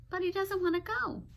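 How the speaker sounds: ordinary talk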